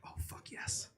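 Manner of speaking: breathy relieved whisper; hushed, thrilled relief. Retold or imitated. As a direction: whisper it like a secret win. Relieved whisper in retold rescue.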